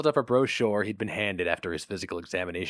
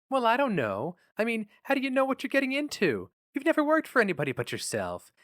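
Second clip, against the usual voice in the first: lightly voiced wife, pitched higher, anxious; a gentle hint of a fretting wife; worried wife; 12 semitones higher than usual. A narrator's reading. Nan on the phone questioning her husband's choice, worried.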